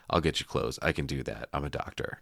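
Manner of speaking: low, quiet, deadpan line read; flat deadpan quote. Retold or imitated. Mimicking the doctor's deadpan line, quiet and flat. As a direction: mutter it flat like a lost man. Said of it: "he's like".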